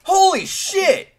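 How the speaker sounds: loud, slow shocked cry; startled. Real, not acted. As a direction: caught off guard by an attack. Squirrel suddenly attacking.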